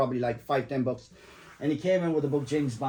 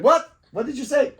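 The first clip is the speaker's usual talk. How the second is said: sharp, much higher and louder "What?"; startled outburst; 10 semitones higher, 8 dB louder than usual. Retold or imitated. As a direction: jolt of shock, then a demand. Cop reacts to the compliment.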